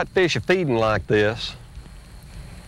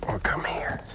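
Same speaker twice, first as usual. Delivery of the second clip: soft, coaxing whisper to the fish; softer, barely voiced plea; 7 dB quieter than usual. Real, not acted.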